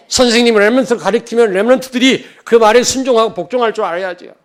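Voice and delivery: loud, high, urgent exhortation; preaching at full force. Real, not acted. Pastor urging the congregation to obey, loud and high. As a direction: drive the point home to the pews.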